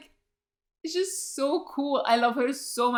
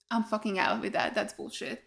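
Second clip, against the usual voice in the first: lower, softer grumble under the breath; muttered; 3 semitones lower, 7 dB quieter than usual. Real, not acted. Annoyed about Cordelia's exit, a soft grumble. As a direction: grumble it to yourself.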